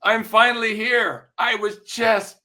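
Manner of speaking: slow, high and tender, a laugh breaking through; heartfelt realization. Acted. Magician speaking to his late mentor, slow tender realization.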